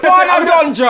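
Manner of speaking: high, loud mocking call; teasing shout. Real, not acted. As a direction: shout it out to tease.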